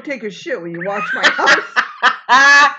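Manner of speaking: loud, high laughing mock of a rich guy. Friend mocking a rich homeowner, loud and laughing. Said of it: "because he's rich".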